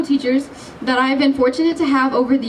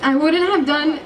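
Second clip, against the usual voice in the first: laughing; 6 semitones higher, slower than usual. Real, not acted. Laughing mid-speech.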